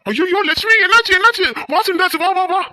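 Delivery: high, loud panicked calling; alarmed cry. Acted. Father rushing to his daughter-in-law's scream, panicked. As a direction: rush in crying out in alarm.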